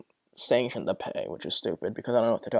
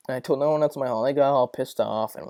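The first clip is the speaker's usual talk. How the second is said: normal talking voice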